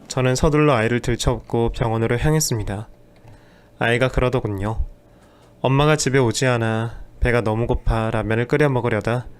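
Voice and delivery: ordinary delivery, nothing marked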